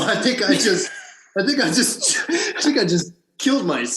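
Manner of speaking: high, laughing through the words. Real, not acted. Actor recalling his supposed career suicide, laughing. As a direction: keep breaking into laughter as you speak.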